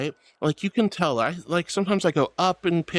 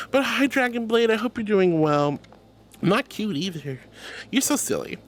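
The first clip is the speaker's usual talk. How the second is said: ordinary delivery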